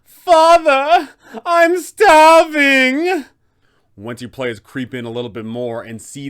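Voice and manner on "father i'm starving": high, loud, starving vampire shriek; blood-curdling scream. Acted. A vampire son locked below shrieks to his father, blood-curdling scream. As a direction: shriek it like a starving monster in the cellar. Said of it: "hear a blood-curdling scream".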